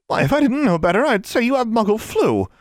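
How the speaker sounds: high shocked nurse voice; exclaiming. A narrator's reading. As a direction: exclaim it, appalled. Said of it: "she exclaimed".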